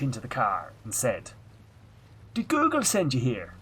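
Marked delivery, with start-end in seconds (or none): 2.3-3.5 higher, louder Irish guard's question; thick Irish accent. Retold or imitated. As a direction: lean in like a Garda officer, thick Irish brogue. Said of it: "in the best accent ever that I can do now".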